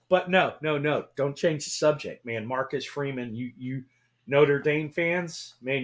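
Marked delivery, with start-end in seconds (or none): none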